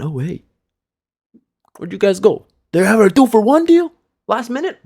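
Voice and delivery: high, loud mocking voice of his friends; mocking buddies. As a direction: squeal it like a gloating friend. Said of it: "My boys went".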